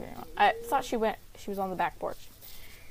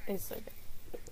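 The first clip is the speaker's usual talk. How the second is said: whisper; 2 semitones lower, 14 dB quieter, slower than usual. Real, not acted.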